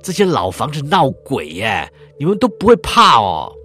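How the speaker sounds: put-on loud big-mouthed kid calling out to friends; loud kid leader. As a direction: bellow it like the brash leader of the gang. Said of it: "嗓门很大".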